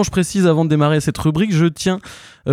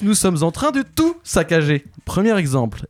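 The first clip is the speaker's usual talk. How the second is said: usual speaking voice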